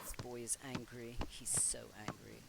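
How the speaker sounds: soft whisper of a remark; whispered aside. Real, not acted. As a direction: lean in and whisper it. Aside about angry Sim.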